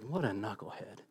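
very soft, low mock mutter; hushed, lightly acted aside; muttered disapproval. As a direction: imitate onlookers judging under their breath. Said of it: "looks going like".

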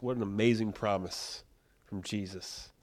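normal talking voice

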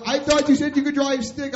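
high, loud accusing shout; retold angry yell. Boss on the radio to new driver, accusing yell. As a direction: imitate a boss yelling an accusation.

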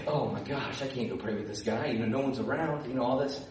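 low, hushed retold dismay; muted reluctance. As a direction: drop low and soft, backing out. Friend reluctant to pray with stranger.

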